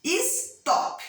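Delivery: "Stop" split as is-TOP, high-pitched; syllable demonstration. Shown as a demonstration. Teacher asking pupils which syllable is stronger, syllable demonstration. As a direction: sound out "Stop" in two separate beats, is... TOP. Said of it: "Qual sílaba fala mais forte?".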